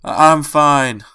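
slow, gasping, loud, choked words; choking out. Acted. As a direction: force it out between gasps. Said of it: "He choked out through gasps for air".

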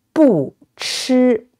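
"不" falls, then "吃" stays high and level; fourth-tone bu. Shown as a demonstration. Teacher giving a phrase to repeat, "不" falling, "吃" high. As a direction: drop "不", sustain a high flat "吃". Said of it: "bu, with the fourth tone".

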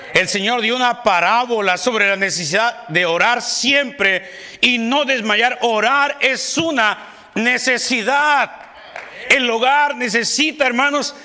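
high, loud fiery preaching; preaching at full force. Acted. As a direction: drive it home to the congregation. Preacher building on prayer.

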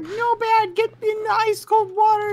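squeaky high mock-dramatic voice; mock falsetto heroine. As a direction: play the sinking heroine in a shrill falsetto. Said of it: "and you're like".

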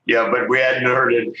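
ordinary talk

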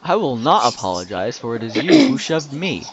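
loud, theatrical defiance with swooping stress; mock-dramatic declaration. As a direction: declare it like a wronged hero in a melodrama.

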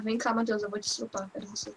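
soft mock menacing murmur; creepy whisper. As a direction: tease him in a hushed creepy voice. Joking creepy line to friend. (sussurro de brincadeira sinistro)

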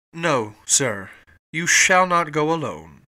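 loud, emphatic refusal; cried out. A narrator's reading. Captain moved by Fogg's resolve, loud cry. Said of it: "cried the captain".

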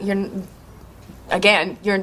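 normal talking voice

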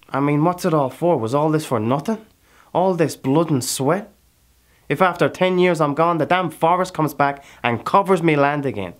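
loud, high, desperate outcry; anguished questioning. Acted. Character despairs over wasted labour.

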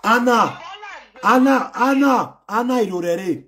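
loud, high drawn-out name call. Real, not acted. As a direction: call Anna over and over. Calling out fan name.

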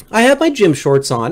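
ordinary talk, nothing marked

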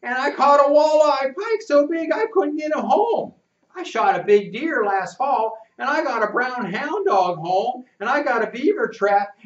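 high, loud tall-tale bragging; boasting boy. Acted. Rufus brags about his hunting to narrator, high and loud. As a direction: pile on the boasts eagerly.

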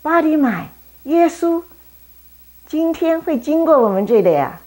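high and loud, eager; passers-by telling Bartimaeus, loud and eager; excited calling. Acted. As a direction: shout the news across the street. Excited townsfolk telling news.

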